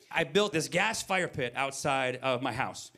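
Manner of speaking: ordinary delivery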